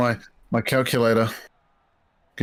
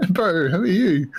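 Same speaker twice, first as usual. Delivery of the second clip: mock puzzled local; 6 semitones higher, slower than usual. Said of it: "I'll be like".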